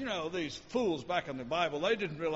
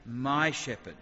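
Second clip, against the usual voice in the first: "My" leaned on, louder; stress on my; 4 semitones lower, 3 dB louder, much slower than usual. Shown as a demonstration. Preacher stressing the personal bond, "My" emphasized. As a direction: press "My" warmly. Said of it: "the ability to say".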